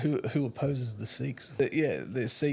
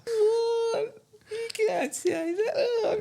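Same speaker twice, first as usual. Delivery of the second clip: very high, loud shrieking mock outrage; outraged church listener; 4 dB louder, much slower than usual. Host mocks an outraged church listener, very high and shrieking. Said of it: "the churches are always like".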